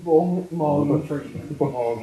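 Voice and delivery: low, soft celebrity impression; Morgan Freeman. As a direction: drop low and quiet to imitate him. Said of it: "I'm Morgan".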